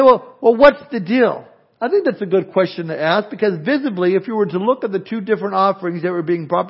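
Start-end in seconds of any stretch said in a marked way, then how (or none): 0.0-1.5 raised, louder mock protest; higher, louder "what's the deal"; puzzled objection. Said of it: "You may say".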